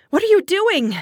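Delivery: high, loud startled question; frightened, pitch leaping up; alarmed gasp. A narrator's reading. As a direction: cry out in alarm. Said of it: "I gasped".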